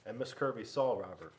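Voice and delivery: ordinary delivery, nothing marked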